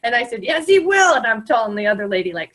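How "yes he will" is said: playful insistence. Pretend. Playful teasing the girl.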